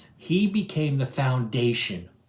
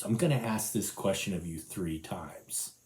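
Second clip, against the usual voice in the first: hushed whisper, much softer; 7 semitones lower, 9 dB quieter than usual. Acted. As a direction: breathe it as a secret. Jesus imagined, whispered.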